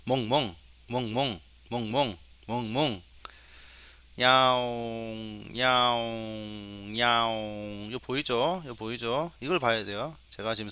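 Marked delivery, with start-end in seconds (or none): 0.0-3.0 repeated short spoken woofs, evenly pitched; dog bark imitation. Shown as a demonstration. Teacher testing the trained dog detection, imitated barking. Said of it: "강아지". 4.2-7.9 long-held meows on one level note; cat meow imitation. Shown as a demonstration. Teacher testing the cat detection, imitated meowing. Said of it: "고양이 한번 해볼까요".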